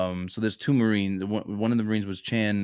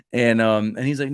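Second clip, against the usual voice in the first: ordinary talk, nothing marked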